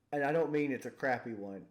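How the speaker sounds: ordinary talk, nothing marked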